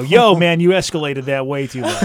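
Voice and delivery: loud mock dude scolding, laughing; a laughing, hammed-up bro. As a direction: bark it like an offended bro, grinning.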